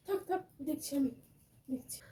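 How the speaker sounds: hushed. Acted. Mother-in-law murmuring over the sleeping bride. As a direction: murmur it so she keeps sleeping.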